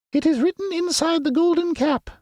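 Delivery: very high squeaky mouse queen; squeaky little voice. A narrator's reading. Queen of the Mice greeting her friends, squeaky little voice. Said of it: "in her squeaky little voice".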